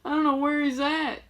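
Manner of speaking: put-on crying girls. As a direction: lightly imitate panicky crying girls. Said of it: "These girls are crying".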